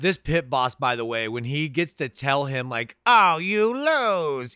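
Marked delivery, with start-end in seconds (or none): 3.1-4.5 slow, drawn-out sneering villain; eighties villain. Retold or imitated. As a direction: gloat it like a cheesy bad guy. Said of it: "like, an eighties villain".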